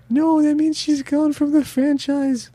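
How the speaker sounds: high, wailing mock grief; hammed-up fan anguish; mock despair. Fan mock-wailing over losing ScarJo, hammed-up despair. Fan mourning Scarlett Johansson's exit.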